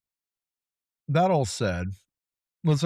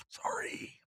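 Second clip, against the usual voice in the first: whispered sorry; 14 dB quieter than usual. Real, not acted. Apologises in a whisper after rambling.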